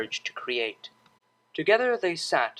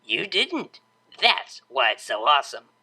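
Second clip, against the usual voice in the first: playfully. A narrator's reading. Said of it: "she said playfully".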